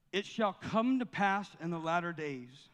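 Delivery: slow scripture reading. Acted. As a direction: proclaim the prophecy slowly. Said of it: "I'm gonna read it slowly".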